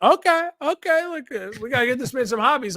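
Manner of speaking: half-laughing put-on voice of the host; amused quote. Host mocking the recruit, amused and exasperated. Stewart quoted reacting to Cam Ward.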